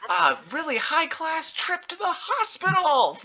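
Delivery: very high, hammed-up announcer shout; hype man. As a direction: spin it upbeat like an over-the-top hype man. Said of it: "still in that hype man voice".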